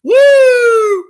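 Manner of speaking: loud, high drawn-out "woo!"; gleeful holler, way up in pitch; excited whoop. Real, not acted. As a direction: let out a long, piercing whoop. Whooping after joking about the card.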